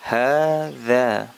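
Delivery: teacher's clear model of hadza; careful Arabic hadza. Shown as a demonstration. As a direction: model hadza slowly for students, ha-dha. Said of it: "dalam pengucapannya".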